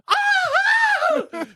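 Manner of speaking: very high woman's scream, imitated; mock scream. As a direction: shriek like the horrified lady. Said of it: "She screams, right?".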